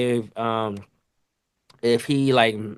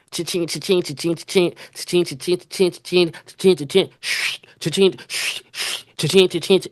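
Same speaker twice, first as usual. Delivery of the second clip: printer cha-ching; 6 semitones higher than usual. Retold or imitated. Cook imitating the kitchen printer during a rush, high mechanical chant.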